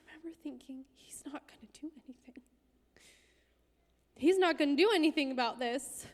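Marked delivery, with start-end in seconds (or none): 0.9-2.4 voiceless private realisation; whispered thought. Retold or imitated. Realising the pastor will do nothing, a whispered inner thought. 4.1-6.1 pitched-up, emphatic repeat with more volume; bitter louder repeat. Retold or imitated. Realising the pastor will do nothing, a louder emphatic repeat.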